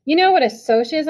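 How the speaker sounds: put-on boy answering an insult with shaking rage. A narrator's reading. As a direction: spit the question back in barely held rage. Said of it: "my voice shaking with rage".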